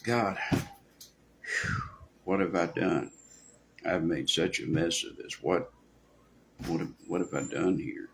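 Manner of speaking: soft, low, heavy with regret; humbled confession of having made a mess; repentant. Shown as a demonstration. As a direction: breathe out, then halting quiet self-reproach. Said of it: "when you say it in the right attitude".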